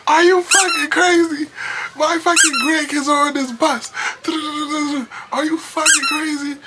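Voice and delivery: high, loud scolding shriek; furious old lady. Retold or imitated. Old lady with a cane yelling at the knife man, high shriek. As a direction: tear into him like a grandma. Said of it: "And she comes and she says".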